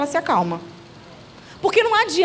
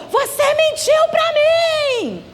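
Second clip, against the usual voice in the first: high, drawn-out yell of accusation; high-pitched tantrum cry, stretched at the end; child's shout; 12 semitones higher, much slower than usual. Retold or imitated. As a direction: wail it out like a child in meltdown. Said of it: "ele gritou".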